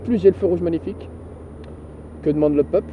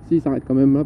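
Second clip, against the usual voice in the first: ordinary talk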